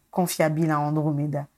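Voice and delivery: ordinary delivery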